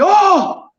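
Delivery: a high startled oh; startled yelp. Retold or imitated. As his eyebrows burn, a high startled oh. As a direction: squeak as the fire flares.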